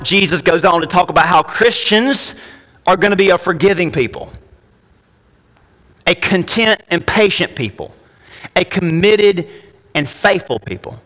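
normal talking voice

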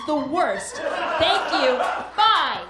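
loud, high laughing shout; yelling. Acted. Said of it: "you can just yell at them".

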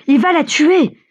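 high, alarmed cry from bystanders; shouting. A narrator's reading. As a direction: cry a warning, pitched well up. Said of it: "crie-t-on autour de lui". (cri d'alarme, voix haute)

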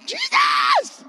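high, piercing mock scream of terror; panicked shriek. Kid in a storm-shaken trailer, screaming in mock panic. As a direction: shriek the name like the sky is falling. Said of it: "And I was like".

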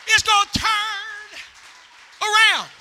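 loud, high, shouted exhortation; full-force preaching. Real, not acted. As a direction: belt it out to rouse the congregation.

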